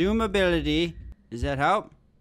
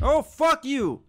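higher, slow frightened yell; startled shout; 9 semitones higher, slower than usual. Real, not acted. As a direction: yelp it out in fright. Jump scare in horror game.

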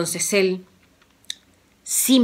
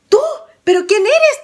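much higher, louder startled question; startled princess; 14 semitones higher, 7 dB louder than usual. Acted. The girl startled by Juan, high and surprised. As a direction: jump at a stranger's voice.

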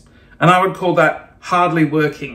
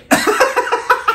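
very high airy giggle. Real, not acted. Vlogger laughing at the quote, high giggle. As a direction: burst into a squeaky giggle.

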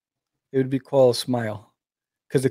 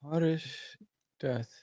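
much softer, whispered musing; 14 dB quieter than usual. Real, not acted. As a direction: whisper the words back while thinking. Echoing the question in whisper.

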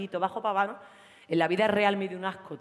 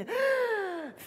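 shocked gasp; 12 semitones higher than usual. Acted. Sees fifty calls on phone.

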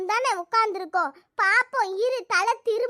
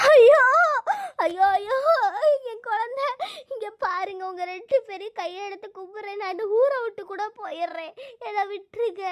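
sobbing plea. Acted. Mother begging both women to spare her, sobbing. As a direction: cry out, then beg weakly. Sobs, begging for baby and release.

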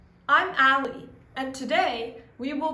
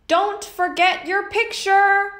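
higher, louder voice calling out a reminder; bus driver reminding; 6 semitones higher, 6 dB louder than usual. Acted. Bus driver calls after the narrator leaving the bus, reminding. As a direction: shout it after the kid stepping off the bus. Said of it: "my bus driver said".

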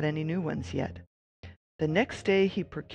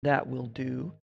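lower thinking voice; 4 semitones lower than usual. Acted. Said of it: "he thought".

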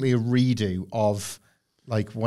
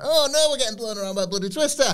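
high, fast panicked voice of a wrestler in a twister; panicked street trash; 10 semitones higher, faster than usual. Acted. Street trash caught in a cartoon twister, high panicked yelp. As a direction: yelp it out fast and high like you're being blown away. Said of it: "They're like".

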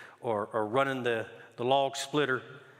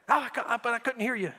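son's excuse; 11 semitones higher, faster than usual. Retold or imitated.

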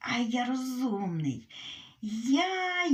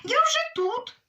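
much higher, loud squeaky call; high shout; 14 semitones higher, 5 dB louder than usual. Acted. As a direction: squeal it out in triumph. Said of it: "а їжачиха йому кричить". (дуже високий гучний вигук)

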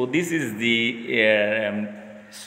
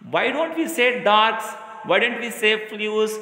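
teacher quizzing the class; 8 semitones higher than usual. Shown as a demonstration. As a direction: quiz young pupils with rising questions. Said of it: "I will ask the children".

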